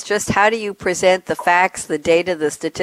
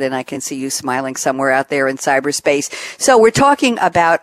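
ordinary talk, nothing marked

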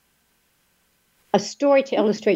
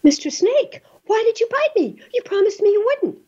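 much higher, wounded little turtle voice; hurt turtle. Acted. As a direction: squeak it out like a betrayed turtle. Betrayed turtle asks the snake.

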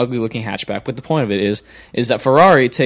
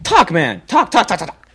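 impatient urging; 5 semitones higher, 3 dB louder than usual. Real, not acted. Host urging guest to talk.

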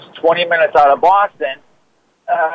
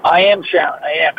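normal talking voice

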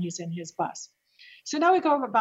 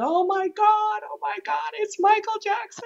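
much higher, thrilled gasp of recognition; excited recognition; 12 semitones higher than usual. Retold or imitated. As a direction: squeal it like starstruck fans. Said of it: "and they all came out".

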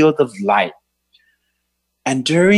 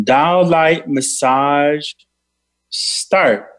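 meditation guide voice; guided meditation. Acted. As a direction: lead a healing meditation. Leads a healing meditation.

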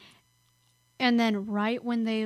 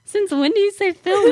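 higher, louder mock disbelief; incredulous; 10 semitones higher, 8 dB louder than usual. Teasing a friend who picked up British words, mock incredulous. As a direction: challenge the word, acting out astonishment.

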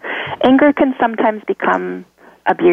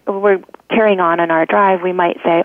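ordinary talk, nothing marked

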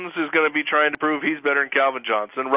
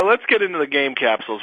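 ordinary delivery

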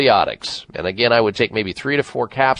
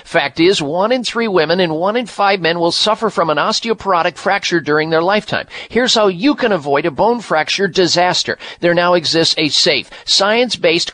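radio ad read; 7 semitones higher than usual. Acted. Reads bone supplement ad.

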